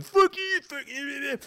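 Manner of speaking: high, squeaky mock scream. Drunk guy screaming in his face, mock scream. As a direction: imitate a screamer without full volume. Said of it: "in his face screaming at him".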